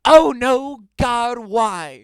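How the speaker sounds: high, loud dramatic wail; dismayed cry. A narrator's reading. Narrator voicing the distressed character's plea, dismayed cry. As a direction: cry out in comic despair.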